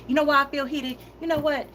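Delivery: a heated burst at the audience. Real, not acted. Venting to viewers about toxic energy, heated.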